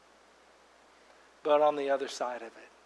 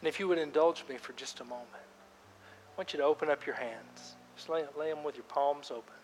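guided prayer; 10 dB quieter than usual. Acted. Pastor guiding congregation in silent prayer, softly. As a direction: lead them gently into quiet prayer. Leads a quiet prayer time.

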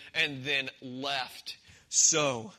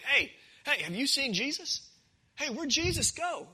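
higher, eager crowd voice calling out; mock crowd calling; 7 semitones higher than usual. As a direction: call out like a searching crowd. Crowd voiced searching for Jesus.

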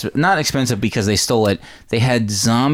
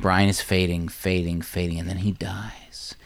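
fading away; 3 semitones lower, 8 dB quieter, slower than usual. Retold or imitated. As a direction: trail off softer into a hushed whisper. Voice fades out with the character.

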